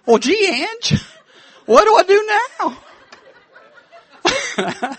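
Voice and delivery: high, jittery nervous voice; Don Knotts. Retold or imitated. Preacher imitates a nervous comic asking the guard what to do, high and jittery. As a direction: squeak like a flustered Don Knotts. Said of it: "Kinda like Don Knotts".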